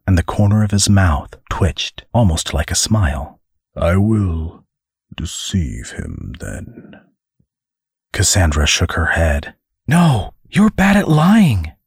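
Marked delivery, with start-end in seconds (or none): 3.7-7.0 a stern angel declares his intent; grave angel. Acted. Angel answers wryly. 9.9-11.7 high, slow, woman's voice; much higher, chiding him; a woman warns her companion he can't lie; exasperated Cassandra. Acted. Cassandra scolds the angel.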